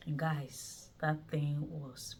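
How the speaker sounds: dropped to a near whisper; hushed. Retold or imitated. Telling viewers about the painful injection, hushed. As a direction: lean in and say it low and hushed.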